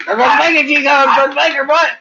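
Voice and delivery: loud, high teasing threat to the dog; high playful yell. Real, not acted. Owner yelling a mock threat at her dog, loud and high. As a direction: shout it up high, half laughing.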